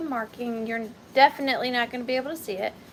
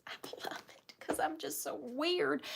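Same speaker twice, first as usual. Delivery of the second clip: soft whisper to yourself; whispered aside; 12 dB quieter than usual. Real, not acted. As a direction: confess it in a whisper. Self-teasing aside.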